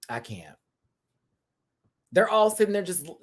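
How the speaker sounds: normal talking voice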